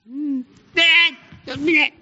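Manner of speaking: high, loud teasing singsong; mock sympathy. Kids teasing their sulking dad, high singsong. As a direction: tease it like a cheeky kid. Kids mocking dad's self-pity.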